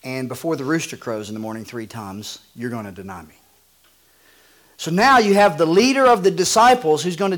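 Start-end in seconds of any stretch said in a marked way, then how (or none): 0.0-3.3 gentle, lower Jesus. Acted. Jesus quietly telling Peter he will deny him. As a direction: lower and softer, calm correction. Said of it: "And Jesus says".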